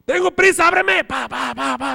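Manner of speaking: very high and loud pleading shout; frantic yell. Retold or imitated. Roommate shouts very high and loud at the locked door. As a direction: scream it, desperate to get in.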